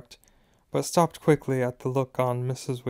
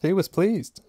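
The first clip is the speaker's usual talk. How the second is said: Fred; 6 semitones higher than usual. A narrator's reading. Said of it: "said Fred".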